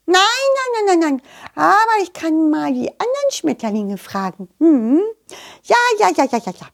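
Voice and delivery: high, rattled-off repeats in a butterfly voice; chattery butterfly. Acted. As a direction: chatter high, eager to help.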